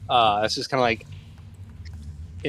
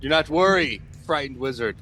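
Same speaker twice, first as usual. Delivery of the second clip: higher, showy hero voice; reassuring hero; 5 semitones higher than usual. Acted. Hero promises to protect frightened wizard, grandly. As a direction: offer protection with grand confidence.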